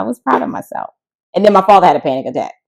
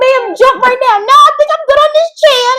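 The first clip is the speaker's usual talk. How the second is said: mock emergency skit; 7 dB louder than usual. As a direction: ham up the panicked passenger. Acted plane emergency skit.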